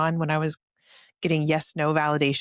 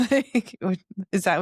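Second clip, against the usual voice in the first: laughing; 4 semitones higher than usual. Real, not acted. Laughs at own story.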